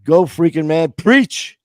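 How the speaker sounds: high, loud cry of preach; hype cheer. Real, not acted. Host cheering himself on after his speech, loud whoop. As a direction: whoop it like a church amen.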